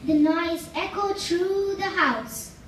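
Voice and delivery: ordinary delivery, nothing marked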